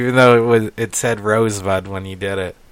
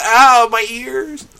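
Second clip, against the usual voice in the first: mock pain; 12 semitones higher, 3 dB louder, slower than usual. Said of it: "They're like".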